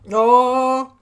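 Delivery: sudden high yell of frustration; loud shout. Real, not acted. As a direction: shout it out in anger. Gaming streamer frustrated outburst.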